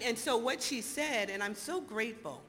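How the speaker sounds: ordinary talk, nothing marked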